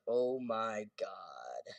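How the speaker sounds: slow drawn-out oh my God; woman slowly amazed by the gorilla. Retold or imitated. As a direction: stretch it out in disbelief. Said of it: "she's like".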